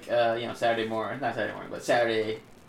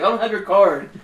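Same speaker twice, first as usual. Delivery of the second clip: high, loud indignant retort; retold defensive outburst; mock protesting denial; 7 semitones higher, 8 dB louder than usual. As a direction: play the accused kid insisting he's innocent.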